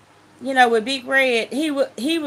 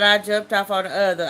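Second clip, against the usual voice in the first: plain reading voice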